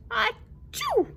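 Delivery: high loud sneeze. Acted. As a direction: burst out a big sneeze. The flea sneezes.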